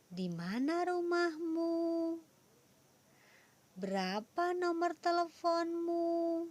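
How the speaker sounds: exaggerated questioning tune; question intonation. Shown as a demonstration. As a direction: ask each as an eager question to a child. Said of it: "ada intonasi yang berbeda".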